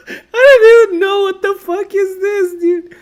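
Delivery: can't believe it, laughing; laughing disbelief. Real, not acted. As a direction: squeal it out through laughter. Laughing at failed park project.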